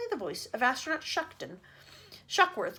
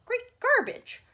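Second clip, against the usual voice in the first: loud, high exclamation; cried out; 12 semitones higher, 7 dB louder, much slower than usual. A narrator's reading. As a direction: explode in outrage. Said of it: "cried the president".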